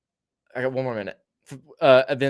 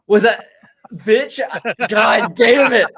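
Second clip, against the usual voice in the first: loud, higher-pitched exasperated cursing; exasperated outburst; 8 semitones higher, 9 dB louder, slower than usual. Real, not acted. Storyteller fumbling his own story, exasperated. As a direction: curse loudly at your own fumbling, dragging it out.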